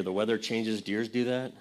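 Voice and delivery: ordinary delivery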